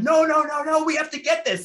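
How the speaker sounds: loud, high-pitched pleading orders; urgent insistence. Retold or imitated. Director to laughing cast who must finish shot, urgent high shout. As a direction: frantically overrule everyone, voice pushed way up.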